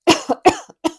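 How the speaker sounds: deliberate demo cough. Shown as a demonstration. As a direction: cough it out to show. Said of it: "I just cough two or three times".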